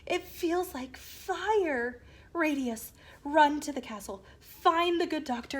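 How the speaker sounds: high, loud, urgent pained commands; pained father's orders. Acted. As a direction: bark orders through the pain. Father in pain orders son.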